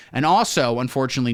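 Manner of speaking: normal talking voice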